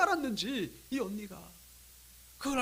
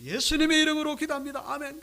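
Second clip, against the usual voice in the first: louder, higher, ringing prayer close; proclaimed prayer; 6 semitones higher, 6 dB louder than usual. Retold or imitated. Prisoner finally remembering the prayer's ending, proclaimed loudly. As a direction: proclaim it with raised pitch and force.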